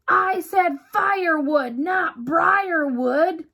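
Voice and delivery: sharp, high, loud yelp with a slow ending. A narrator's reading. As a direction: yelp it out in pain and annoyance. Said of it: "he yelped".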